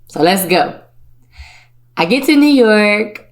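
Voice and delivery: ordinary talk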